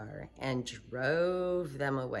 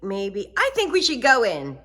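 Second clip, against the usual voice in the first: put-on high eager girl, getting louder; little girl pushing ahead; Sheila's voice; 6 semitones higher, 8 dB louder than usual. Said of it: "said Sheila".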